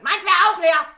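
very loud, high-pitched yell; scream. Real, not acted. Ignored guest demanding a refill, screamed. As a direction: scream it to finally get heard. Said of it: "Ich glaub, ich muss schreien".

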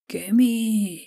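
high drawn-out call into the wind; shout. A narrator's reading. Davos hails the ship from the rock, shouting. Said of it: "diye bağırdı rüzgara".

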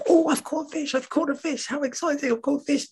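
very high squeaky rabbit voice; very high, soft excited rabbit. Acted. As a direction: squeal it like a delighted little rabbit. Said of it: "So she's".

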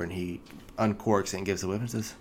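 usual speaking voice

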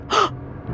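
a sudden high gasp of shock; sharp gasp. Retold or imitated. Catching sight of Jamie in his room, a startled gasp. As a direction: gasp as if caught off guard.

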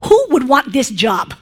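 ordinary talk

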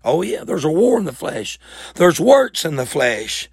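normal talking voice

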